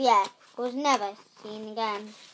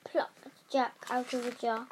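usual speaking voice

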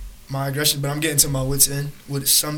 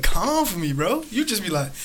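ordinary delivery

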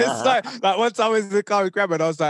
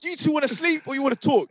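exasperated demand; 9 semitones higher than usual. Retold or imitated. Annoyed at friends talking at night.

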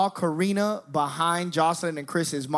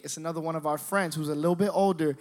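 ordinary delivery

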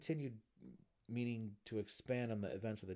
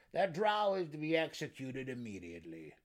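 raised, loud gnome voice; 5 semitones higher, 7 dB louder than usual. A narrator's reading. Gnome ordering the drow's execution, loud declaration. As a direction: declare it loudly as a gnome councilor. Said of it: "one of them said".

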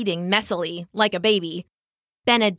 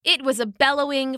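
ordinary delivery, nothing marked

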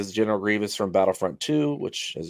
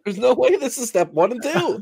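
incredulous; 12 semitones higher, 4 dB louder than usual. Acted. Mock disbelief at instruction steps.

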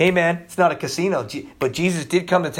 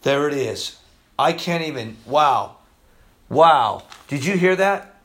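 ordinary talk, nothing marked

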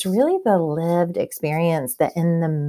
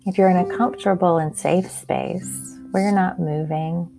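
calm grounding exercise. Acted. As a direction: guide them gently into their senses. Leads a grounding meditation.

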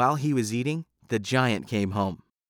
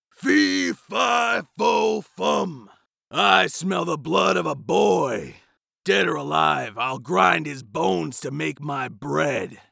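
high, loud giant's roar, slowing into a threat; giant crying out; 4 semitones higher, 4 dB louder, slower than usual. A narrator's reading. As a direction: bellow like a hungry giant. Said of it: "cried the giant".

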